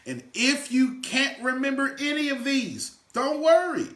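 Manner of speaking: high and loud, lifting them up; excited reassurance. Real, not acted. Coach to class, loud excited reassurance. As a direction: cheer them on, don't worry.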